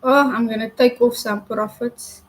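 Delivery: ordinary talk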